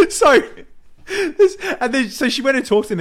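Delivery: high, loud, struggling to resume; laughter-squeezed high voice; talking through laughter. Real, not acted. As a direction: restart the story while still cracking up. Recovering from laughing fit.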